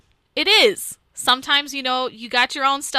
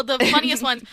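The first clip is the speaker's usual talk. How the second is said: ordinary talk, nothing marked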